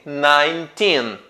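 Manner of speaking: put-on nineteen slowly, with a long "teen"; "nineteen" drawn out on teen; stress on TEEN. Shown as a demonstration. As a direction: lean on the last syllable of "nineteen". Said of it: "com o teen na sílaba mais forte".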